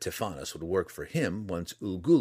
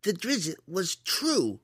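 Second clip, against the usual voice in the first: put-on very high, slow boy's protest; insistent protest; 11 semitones higher, much slower than usual. A narrator's reading. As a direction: dig in, stretching each word. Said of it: "Liam protested again".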